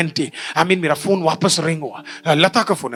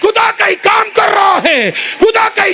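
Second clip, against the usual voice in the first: high, loud, slow proclamation shouted to the church; preaching at full force; 13 semitones higher, 7 dB louder, slower than usual. Acted. Pastor shouting God's power to the congregation, full-force preaching. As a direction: build to a roaring altar-call climax.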